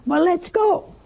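high, eager exclamation; excited. Acted. Excited man urging Jesus onward, high eager voice. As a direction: pitch it up high, raring to go. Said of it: "he was so excited".

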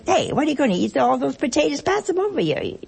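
hammed-up dinner squabble, laughing; a noisy relative demanding the potatoes, mock rough; mock quarrel at dinner. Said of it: "And somebody would say".

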